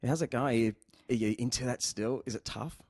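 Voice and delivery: ordinary talk, nothing marked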